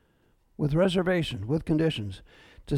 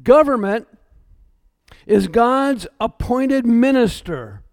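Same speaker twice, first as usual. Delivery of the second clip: slow, measured, "Government" punched louder; main point, emphasized; 3 dB louder, slower than usual. Shown as a demonstration. Pastor announces the sermon's main point, slow and emphatic. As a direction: lay out the main point slowly, word by word. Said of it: "here's our main point".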